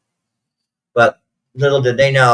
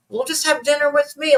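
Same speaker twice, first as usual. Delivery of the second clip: much higher, lightly imitated grandmother voice; imitating grandmother. Quoting her sick grandmother begging her to stay for dinner, high imitated voice. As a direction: put on a high pleading grandma voice. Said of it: "And she's like".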